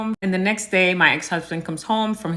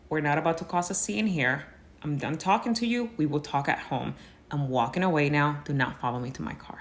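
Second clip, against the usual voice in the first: much softer, low and controlled; quiet, cold firmness; 4 semitones lower, 9 dB quieter than usual. Retold or imitated. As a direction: shut him down without raising your voice. Refusing a scene in public.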